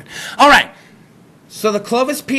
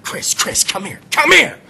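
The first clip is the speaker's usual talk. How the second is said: whisper. Retold or imitated. Mom calling son urgently.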